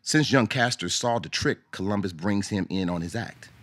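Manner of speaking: usual speaking voice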